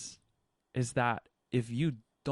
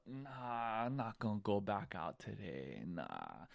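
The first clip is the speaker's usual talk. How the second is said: softer, beaten-down refusal; defeated; 2 semitones lower, 9 dB quieter than usual. Pretend. Wounded surfer looking back out, softly defeated. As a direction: give up quietly, spent. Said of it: "You look back out".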